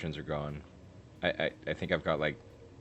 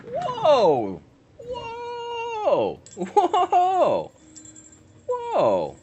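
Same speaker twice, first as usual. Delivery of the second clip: very high loud whoa; shouting whoa; 11 dB louder, much slower than usual. Real, not acted. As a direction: yell it in shock.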